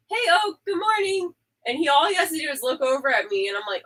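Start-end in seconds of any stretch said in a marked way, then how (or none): 0.1-1.3 mock chirpy greeting. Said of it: "I'll be like".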